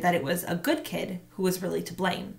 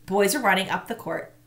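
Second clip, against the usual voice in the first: ordinary delivery, nothing marked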